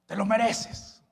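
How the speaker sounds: gulping, then a spiteful mutter; bitter grudge-holder. Acted. Preacher voicing a resentful person drinking poison, spitefully. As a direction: act out someone sipping poison out of spite.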